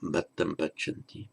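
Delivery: "Bhattam pachanti" said quietly. Shown as a demonstration. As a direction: drop to a soft short form.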